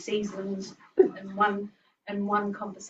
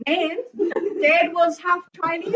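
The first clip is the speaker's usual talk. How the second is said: high, loud, laughing; laughing retort; 9 semitones higher, 7 dB louder than usual. Retold or imitated. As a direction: answer Nan through laughter. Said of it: "I go".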